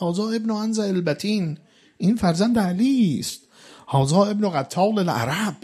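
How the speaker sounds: high, urgent preaching; preaching at full force. Acted. Preacher voicing the enemy commander shouting to his troops, high and urgent. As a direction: drive the story up to a peak.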